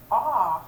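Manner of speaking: aw said loud and open; teacher models aw for A U; sound demo. Shown as a demonstration. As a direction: say aw clearly and loudly. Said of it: "And A U".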